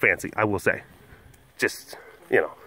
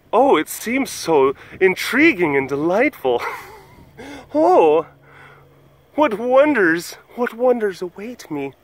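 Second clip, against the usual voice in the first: higher, slow, awed storybook voice; dreamy wonder; 6 semitones higher, slower than usual. Pretend. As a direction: marvel at a magical world. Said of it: "some people might say".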